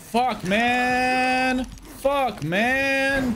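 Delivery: high, drawn-out complaint; exasperated high wail; frustrated whine. Real, not acted. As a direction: moan it on a long high note. Player complains while being attacked in game.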